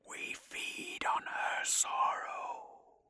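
slow, drawn-out demonic whisper; eerie inner voice, all breath; sinister whisper. A narrator's reading. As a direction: whisper it slowly like an intruding thought. Sinister thought rising in his mind.

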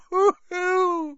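high, wailing sob; high-pitched boo-hoo wail; loud tearful creature sobbing; elf sob. A narrator's reading. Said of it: "he sobbed".

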